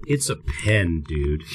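flat, fading, fed-up deadpan; exasperated. Retold or imitated. Telling the cop it's just a pen, exasperated deadpan. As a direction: roll your eyes as you say it. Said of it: "I roll my eyes and I slap my head and I go".

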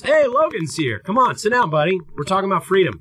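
high, hammed-up friendly cop; mock cop greeting. Cop greeting newcomer Logan, high hammed-up cheer. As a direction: welcome him in like a goofy cop. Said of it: "he goes".

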